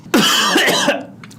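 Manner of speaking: coughing up the spice; high raspy cough. Real, not acted. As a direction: choke out a sharp cough. Choking on spicy food.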